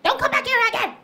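high, sharp yell to chase them off; woman yelling at bad boys. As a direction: shoo the bad boys away, high and fierce. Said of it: "yelled at the boys again".

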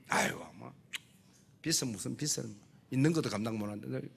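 low, soft grumbling excuse voice; a reluctant listener mumbling excuses; grumbling congregant. Retold or imitated. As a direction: mutter like a doubting churchgoer. Imitating reluctant congregant muttering.

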